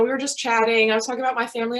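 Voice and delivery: ordinary talk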